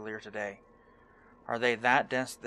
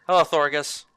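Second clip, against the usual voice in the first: louder, boisterous put-on trainer voice; hearty hunter trainer; 3 semitones higher, 7 dB louder than usual. Streamer voicing hunter trainer greeting player, jolly put-on voice.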